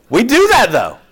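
loud, high insistence; forceful preaching. Real, not acted. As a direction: hammer the point home to the church. Preacher presses the point.

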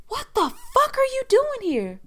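loud, very high-pitched challenge; retold outraged confrontation. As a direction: act out yelling at an intruder.

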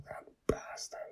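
hushed, disgusted aside; soured whisper. Acted. Justin whispers his disgust at Captain Badass. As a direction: spit it out under the breath. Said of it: "Justin makes a face".

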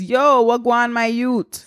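put-on half-hearted Jamaican accent greeting. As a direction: read it in a playful Jamaican accent. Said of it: "Thank you for doing the Jamaican".

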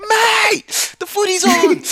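high, loud Australian shout; mock Australian accent. Bugging a sleeping Australian friend, shouted Aussie accent. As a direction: holler it like an excited Aussie footy fan. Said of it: "I had a friend from Australia".

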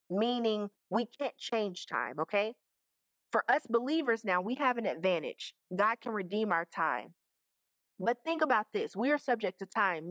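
ordinary talk

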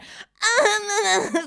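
high, loud wordless mock whine; high-pitched babble of annoyance, imitated; imitated annoyed noise. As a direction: burst into an exaggerated gripe noise. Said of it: "Så säger jag så här".

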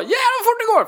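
very high-pitched acted yelp; alarmed exclamation. Child racing down a hill on miniskis, high panicky whoop. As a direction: cry it out as if speeding out of control. Voices himself speeding downhill.

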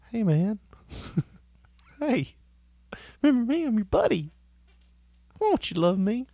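syrupy begging dog voice; sweet talking dog. Retold or imitated. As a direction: coax him like a needy puppy.

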